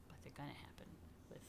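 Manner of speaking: hushed confiding whisper; whispered promise to the kids. Acted. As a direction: share it like a little secret. Secret promise to children.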